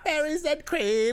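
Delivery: high, slow squeaky meme voice; meme squeal. Pretend. Meme catchphrase echoed high.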